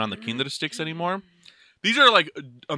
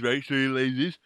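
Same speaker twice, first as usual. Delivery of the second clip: usual speaking voice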